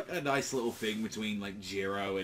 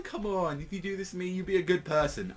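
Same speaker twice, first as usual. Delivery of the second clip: raised pitch, lightly voiced pleading girl; mock pleading Jiro, higher than usual; 8 semitones higher than usual. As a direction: coax him in a higher, sweeter voice. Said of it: "And Jiro's like".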